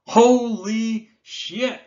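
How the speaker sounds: slow, drawn-out, high and loud gasped exclamation; stunned awe. Real, not acted. Collector seeing his prized Necropotence foil, slow stunned awe. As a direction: let the words fall out in sheer disbelief.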